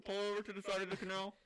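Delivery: high, loud mock police hail; ocean police. Police stopping ship in canal, hammed-up hailing voice. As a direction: order the ship over like a cop.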